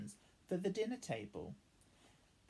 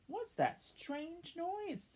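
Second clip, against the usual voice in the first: lightly voiced child; much slower than usual. Child wondering at snoring.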